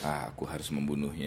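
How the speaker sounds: quiet, hammed-up soap villain line; overwrought soap opera gasps. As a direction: mutter it like a TV drama villain. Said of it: "Kayak sinetron kan".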